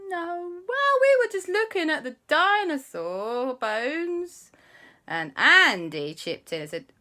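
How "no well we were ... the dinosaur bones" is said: high, slow, sing-out fake innocence; stall, then cover up unconvincingly; evasive. Auntie hides the trip from relatives, evasive.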